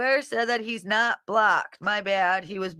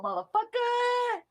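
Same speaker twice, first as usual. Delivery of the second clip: high drawn-out curse; 3 dB quieter than usual. Real, not acted. Searching for eight ball.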